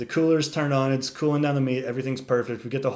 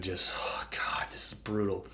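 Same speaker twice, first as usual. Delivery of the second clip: soft, breathless whisper of someone worn out; exhausted whisper; 7 semitones lower, 11 dB quieter than usual. Retold or imitated. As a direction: whisper it like you can barely stand. Exhausted after fourteen miles walking.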